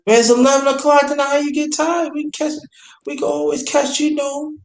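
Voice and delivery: very high, whiny-pitched objection; incredulous retold protest. Man protesting that his ex is tired already, incredulous. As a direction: object in disbelief, pitched right up.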